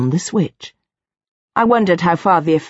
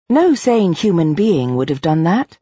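ordinary delivery, nothing marked

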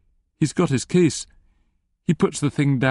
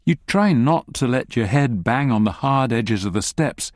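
ordinary delivery

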